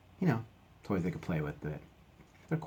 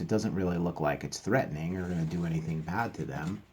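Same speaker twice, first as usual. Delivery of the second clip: usual speaking voice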